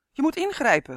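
high, loud, urgent demand; calling out. A narrator's reading. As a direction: cry out for help to step in. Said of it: "riep Dummy".

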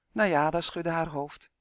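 ordinary delivery

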